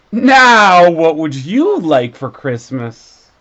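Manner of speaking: loud start, kindly Santa; Santa asking. A narrator's reading. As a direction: Santa asks the boy on his knee. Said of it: "he asked".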